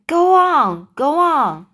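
loud, high-pitched urging; frantic cry. A narrator's reading. Whole company pressing James to continue, frantic cry. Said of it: "Then they cried frantically".

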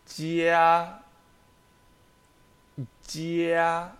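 jia held high and level, first tone; slow teacher demo of jia in first tone. Shown as a demonstration. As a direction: sing out jia high and flat. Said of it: "con primer tono".

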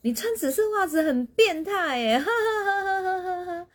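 high, loud teasing with a burst of laughter; mocking laugh. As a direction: poke fun at his purple socks and crack up. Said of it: "我就跟他说".

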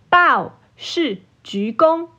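usual speaking voice

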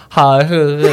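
loud numb laughing; numbed dopey laugh; dazed laugh. Retold or imitated. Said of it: "我就起来说".

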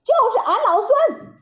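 high, loud defiant monkey yell; loud shout. Acted. Monkey King shouting at the Jade Emperor, defiant yell. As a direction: bellow it out proudly. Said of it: "大叫一声".

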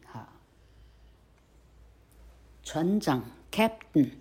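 ordinary delivery

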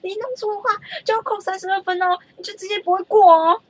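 the coach warning about losing points; imitated warning instructor. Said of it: "他就觉得".

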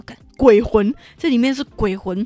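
loud sudden ghost scare; jump-scare the kid; spooky shout. Retold or imitated. Dad shouting at son that it's a ghost. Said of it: "那我爸就说".

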